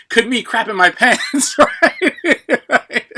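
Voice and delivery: high, loud laughing through words. Real, not acted. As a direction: crack up at your own joke. Joking about a viewer question.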